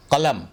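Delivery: "कलम" starting far back in the throat; correct pronunciation. Shown as a demonstration. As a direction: say "कलम" as qalam. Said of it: "कलम नहीं कलम".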